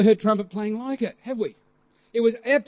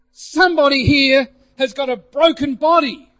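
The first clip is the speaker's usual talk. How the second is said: louder, higher retold proclamation; proclaiming; 4 semitones higher, 5 dB louder than usual. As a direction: announce it to the whole room. Retold announcement from the platform.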